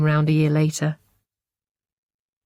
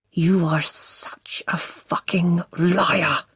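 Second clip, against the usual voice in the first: slow, strangled anger; strangled fury; much slower than usual. Acted. Husband accusing his wife, slow strangled fury. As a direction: force the words out slowly through rage. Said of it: "His voice sounds strangled".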